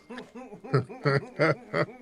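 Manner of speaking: real, drawn-out teasing laugh. Real, not acted. Host teasing caller about locking them doors, laughing.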